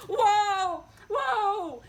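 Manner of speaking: loud high shout, falling; shouted whoa. A narrator's reading. Tacey horse trying to stop, loud shouted whoa. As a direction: call out like reining in a horse. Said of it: "shouted the Tacey horse".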